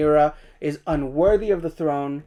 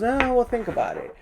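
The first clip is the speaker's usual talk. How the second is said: higher, lightly dismissive mock reply; mock dismissive snicker; 7 semitones higher, 4 dB quieter than usual. Host retelling her reply to the proposal, mildly snickering. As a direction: imitate brushing it off, pitched up. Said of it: "she kinda just snickers about it".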